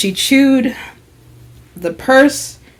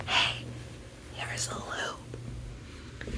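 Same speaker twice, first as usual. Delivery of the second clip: very soft, secretive whisper; discreet, drawn-out whisper; hushed whisper; 24 dB quieter, much slower than usual. Acted. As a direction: whisper it slowly, keeping it on the down low. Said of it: "keep it on the down low".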